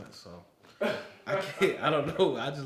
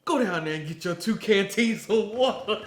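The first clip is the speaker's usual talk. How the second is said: louder, higher order; mock drill instructor order; 8 semitones higher, 9 dB louder than usual. Drill instructor sends recruits for water, loud. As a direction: command it with glee. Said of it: "he said".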